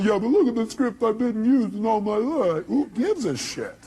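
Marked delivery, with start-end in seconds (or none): 0.0-2.7 high put-on voice of an objector; mock whining. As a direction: lightly whine the excuse. Mocking an objector's excuse.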